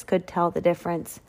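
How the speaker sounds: plain reading voice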